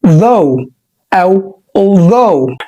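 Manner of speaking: "although" drawn out very slowly; slow model pronunciation. Shown as a demonstration. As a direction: stretch "though" and "although" for the learner. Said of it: "زي كلمة". (نطق although ببطء شديد)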